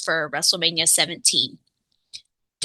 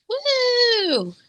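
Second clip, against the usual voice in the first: excited cheer; 14 semitones higher than usual. Real, not acted. Cheering about Mania season.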